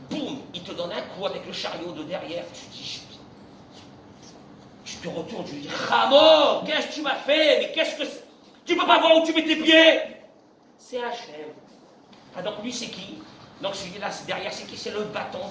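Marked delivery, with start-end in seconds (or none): 5.7-10.0 loud, high indignant outburst; mock angry shopper. Rabbi acting a shopper hit by a trolley, loud angry outburst. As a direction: snap at him like an angry shopper.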